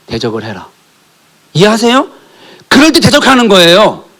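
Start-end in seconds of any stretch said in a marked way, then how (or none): 1.5-4.0 fervent sermon climax; full-force preaching. Acted. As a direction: preach it out at the congregation.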